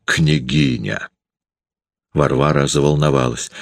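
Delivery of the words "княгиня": low, slow sneering jab; a husband's low, slow sneer; spiteful and mocking. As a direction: spit the word out in mockery, low and slow. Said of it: "вымолвил злобно и насмешливо".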